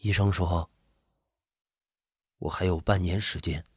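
low, soft, halting confession; low, subdued. A narrator's reading. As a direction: break the bad news quietly. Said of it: "低低地说".

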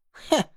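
short sneering huff through the nose; scoffing snort. Acted. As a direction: scoff at her dismissively. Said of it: "嗤笑了一声".

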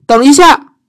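high, loud call to stop; shouting. Acted. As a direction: yell for him to wait. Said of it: "伊丽莎白大叫".

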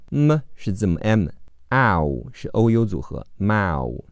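usual speaking voice

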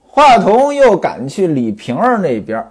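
usual speaking voice

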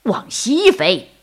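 slow, emphatic eagle voice; stubborn eagle drawing out each word; quarrelling eagle. A narrator's reading. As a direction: counter firmly in a put-on bird voice. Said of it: "老鹰说".

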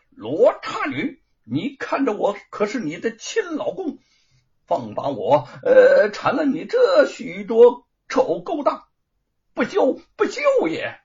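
sharp high yell, rising to the end; stern shout. A narrator's reading. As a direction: bellow it fiercely at her. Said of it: "厉声高叫道".

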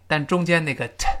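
ordinary delivery

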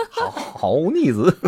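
laughing through the words, slowly; real laughter breaking into the line. Real, not acted. Laughs at cohost's joke. (笑着慢慢说)

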